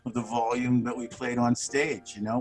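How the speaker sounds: usual speaking voice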